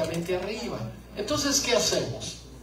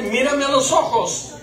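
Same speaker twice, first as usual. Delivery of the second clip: higher and louder, demanding eye contact; firm command; 6 semitones higher, 7 dB louder than usual. Shown as a demonstration. Wife telling husband to look at her, a louder firm command. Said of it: "y dile".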